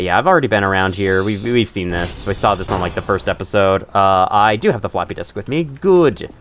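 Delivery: usual speaking voice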